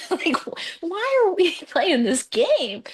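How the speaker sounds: high, slow, laughing half-whisper; incredulous laughing voice; laughing disbelief. Retold or imitated. Stunned by the fraud.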